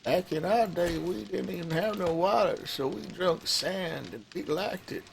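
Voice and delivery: put-on creaky old-timer complaining; grumpy old geezer griping; old man voice. Old-timer grumbling bit.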